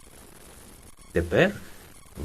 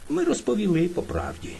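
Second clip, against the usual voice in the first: plain reading voice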